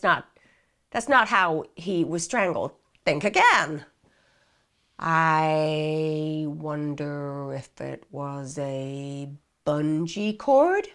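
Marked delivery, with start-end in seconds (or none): 5.0-10.9 lightly voiced dim suspect, very slow and low; slow vague guess. Suspect fumbling a guess to interrogators, very slow and uncertain. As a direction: drag it out as a clueless guess.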